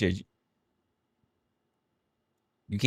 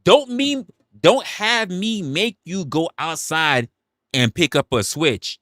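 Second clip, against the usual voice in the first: much higher, louder mock mother's threat; scolding Southern mom; 9 semitones higher, 4 dB louder, slower than usual. Streamer mimicking mom in chat, high scolding voice. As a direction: mimic mom warning about a switch. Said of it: "here's what you sound like, Mama".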